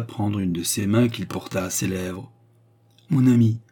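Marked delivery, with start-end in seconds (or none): 3.1-3.6 fond but firm address; a mild reproof with affection; half stern, half affectionate. A narrator's reading. Young woman addresses her suitor, half stern, half affectionate. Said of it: "d'un ton moitié sévère et moitié affectueux".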